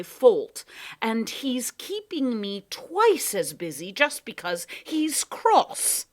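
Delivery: slow, loud put-upon complaint; a harried underling griping; aggrieved Proc. Acted. Proc grumbles to the cat about the Whangdoodle, aggrieved.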